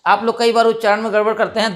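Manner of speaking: ordinary delivery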